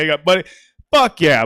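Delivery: ordinary delivery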